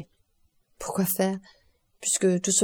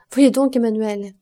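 louder, urgent call to a friend; alarmed call; 3 semitones higher, 7 dB louder than usual. A narrator's reading. Morel urging Emmanuel to look, alarmed louder call. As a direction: grab his friend's attention, shaken.

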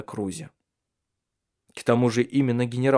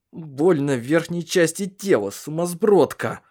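slow, pained, angry outburst, pitched up; angry hiss; 5 semitones higher, slower than usual. A narrator's reading. Man in pain scolding her, angry and slow. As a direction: snap through the pain, dragging the words. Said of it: "прошипел он".

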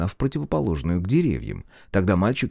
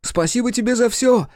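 calling out high and loud; shouting; 4 dB louder than usual. A narrator's reading. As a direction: shout thanks after the dolphin. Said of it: "прокричал мальчик".

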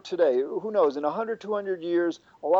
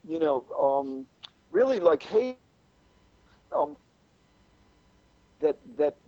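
choppy, breaking-up connection; corrupted glitchy audio; 5 semitones higher than usual. Real, not acted. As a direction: audio dropping out mid-word. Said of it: "Your audio is cutting out a little bit".

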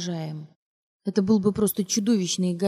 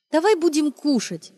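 higher, louder bright cheer; cheerful; 8 semitones higher, 4 dB louder than usual. Acted. Mother putting son down, higher forced cheer. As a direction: sound jolly for the child. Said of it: "весело сказала она".

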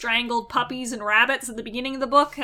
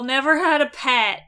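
normal talking voice

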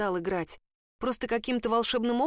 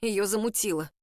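usual speaking voice

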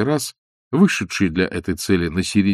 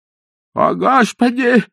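put-on high, mumbling old man's cry; 5 dB louder, slower than usual. A narrator's reading. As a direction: lisp it toothlessly like a frightened old man. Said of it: "зашамкал обитатель".

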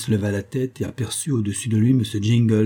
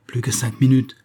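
ordinary delivery

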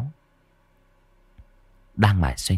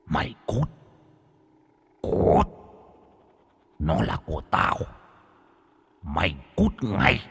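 dark whisper behind you; 4 dB quieter than usual. A narrator's reading. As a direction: hiss it like a spirit behind him. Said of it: "âm thanh rít lên".

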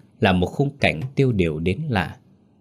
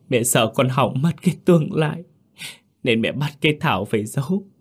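tearful, high sobbing confession; vừa khóc vừa kể; 5 semitones higher than usual. A narrator's reading. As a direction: tell it through hiccuping sobs. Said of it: "vừa khóc vừa kể trong tiếng nấc". (kể trong tiếng nấc)

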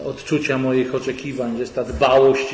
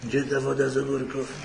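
a lightly hoarse, groggy morning voice, softer; hoarse husband; 5 dB quieter than usual. Retold or imitated. Husband just up, asking for pickle water, lightly hoarse. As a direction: croak it like a husband just out of bed. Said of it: "lekko zachrypniętym głosem".

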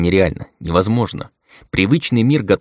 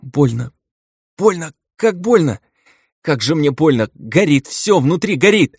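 much higher, whining pain cries; whimpering in pain; 8 semitones higher than usual. A narrator's reading. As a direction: whimper like a beaten puppy. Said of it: "заскулил, словно побитый щенок".

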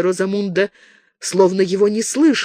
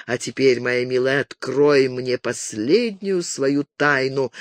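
usual speaking voice

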